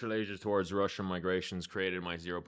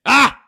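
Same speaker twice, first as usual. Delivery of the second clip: very high, very loud short shriek; short yelp; 20 dB louder than usual. Real, not acted. Player losing on time, short loud shriek. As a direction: yelp sharply at the top of your range.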